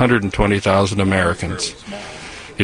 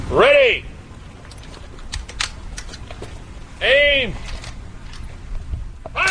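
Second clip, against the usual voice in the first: firing squad command; 4 dB quieter, slower than usual. Real, not acted. Honor guard firing commands.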